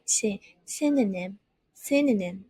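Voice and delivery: synonym said SIN-i-nim with a short i; model pronunciation. Shown as a demonstration. Teacher drilling synonym for students, careful and clear. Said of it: "it's gonna be with the it sound".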